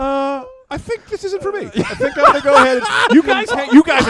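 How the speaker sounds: high, hammed-up timid little kid; mimicking his younger self asking to get off the ride; mocking own timid voice. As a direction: play your scared younger self, squeaky and polite. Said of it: "I go".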